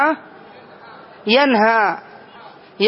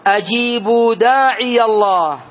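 slow recitation. Shown as a demonstration. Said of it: "recites verse example".

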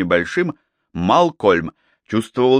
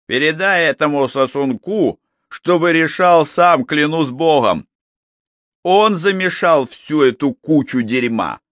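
angry; 6 semitones higher, 4 dB louder than usual. Acted. Gornt angrily refusing, curses.

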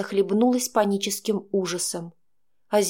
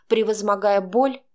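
plain reading voice

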